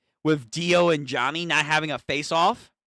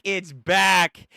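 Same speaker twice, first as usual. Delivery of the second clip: ordinary talk, nothing marked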